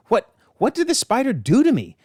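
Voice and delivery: high, stammered acted bewilderment; mock-distressed question; stunned disbelief. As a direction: pitch it up as if badly shaken. Cain acted out, stunned and shaken.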